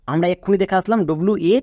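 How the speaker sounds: ordinary delivery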